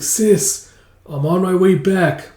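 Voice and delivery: lightly acted plaintive cry; acted calling out. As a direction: call slowly to a loved one. Calling to dying sister.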